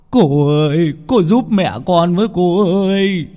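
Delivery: high, loud wailing plea; crying, pleading. A narrator's reading. Man on his knees clutching Cô Năm's legs, wailing and begging for help. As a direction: beg through tears. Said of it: "mà khóc lóc".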